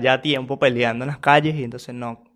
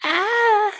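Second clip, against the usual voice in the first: short, very high mock scream; high-pitched shriek imitating the fighter; imitated scream. Commentator copying the sudden scream from the clip, high mock shriek. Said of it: "ese grito así como que".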